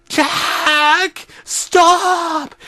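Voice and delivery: high pleading cry; pleading scream. A narrator's reading. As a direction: beg him to stop, crying out. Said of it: "I screamed".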